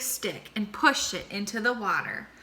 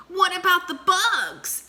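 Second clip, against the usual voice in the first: lightly voiced monkey; 9 semitones higher, 5 dB louder than usual. A narrator's reading. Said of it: "asked Monkey".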